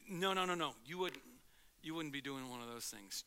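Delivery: ordinary talk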